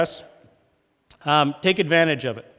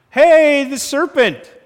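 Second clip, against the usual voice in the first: higher, much louder blame-shifting cry; defensive Eve; 10 semitones higher, 10 dB louder, slower than usual. Retold or imitated. Eve answering God, high loud defensive blame. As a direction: push the blame onto the serpent. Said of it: "Eve says".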